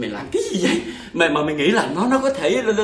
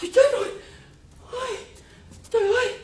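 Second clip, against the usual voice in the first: panicked calling; 11 semitones higher than usual. Acted. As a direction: cry out for the maid in panic. Master panics, calls servant.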